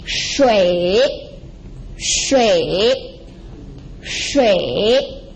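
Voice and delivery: shui dips low, then climbs back up; falling-rising tone. Shown as a demonstration. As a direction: stretch shui in two parts, down then up. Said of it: "a falling and rising tone".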